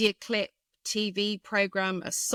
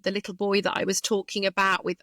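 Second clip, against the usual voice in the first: usual speaking voice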